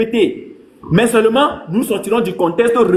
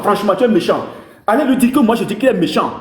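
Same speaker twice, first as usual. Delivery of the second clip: ordinary delivery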